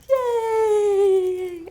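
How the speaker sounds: high, bright squeal of joy; excited cheer. Pretend. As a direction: cheer like the class is with you. Cheering with imagined class.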